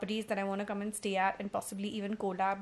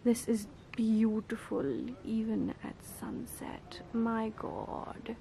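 whispering; 2 semitones higher, slower than usual. Real, not acted. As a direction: hush it out, slowly. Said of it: "I don't know why I'm whispering".

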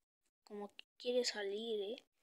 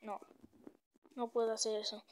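usual speaking voice

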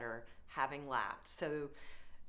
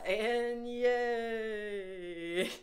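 louder, long-held yay; drawn-out cheer; 4 semitones higher, 5 dB louder than usual. Shown as a demonstration. Yoga leader ending the group chant, long cheering yay. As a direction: cheer it out and hold the vowel.

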